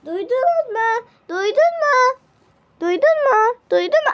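high, loud repeated call; a child calling out, over and over. Acted. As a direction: shout up to make them hear. Calling out to be heard.

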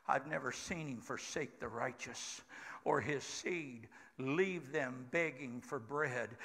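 soft, slow and low, speaking from the heart; quiet testimony. Acted. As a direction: confide it gently to the congregation.

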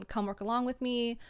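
normal talking voice

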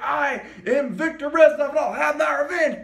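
mock-dramatic quote of an avenger; Viktor Reznov. Quoting game character's vengeful declaration.

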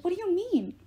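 alarmed, high-pitched question; demanding. A narrator's reading. Percy questions Apollo over collapsed Rachel, demanding. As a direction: press hard for an answer. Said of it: "I demanded".

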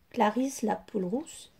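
usual speaking voice

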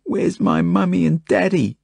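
put-on high-pitched tearful little-girl wail; wailing child. A narrator's reading. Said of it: "she wails".

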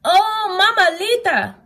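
high frightened cry out; scared kitten crying for his mama. Acted. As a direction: gasp it in high-pitched fright. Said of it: "cried Skipito".

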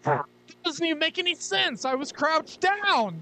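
high-pitched stunned disbelief; real disbelief, pitched high; incredulous squeal. Real, not acted. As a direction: voice jumps up in shock. Disbelief at game glitch.

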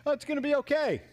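high, singsong brush-off; mock carefree reassurance; breezy dismissal. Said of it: "and you're going".

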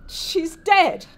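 acted tearful protest, high and loud; sobbing. Grieving wife to her husband about her mother, sobbing. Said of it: "starts sobbing and crying".

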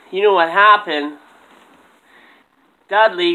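ordinary delivery

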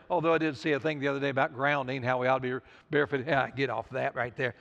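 low, soft, quick aside; throwaway aside. Real, not acted. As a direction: toss it off lightly under the preaching. Off-topic aside, then drops it.

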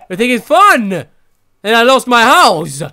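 yelling that it isn't funny; loud indignant. Real, not acted. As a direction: shout the question at them. Repeats, louder.

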